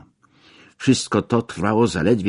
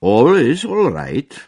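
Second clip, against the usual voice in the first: forced reassurance; 10 semitones higher, 7 dB louder, slower than usual. A narrator's reading. Brushing off Miss Smith's question.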